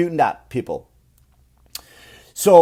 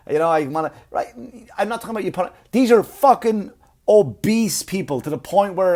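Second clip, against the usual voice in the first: usual speaking voice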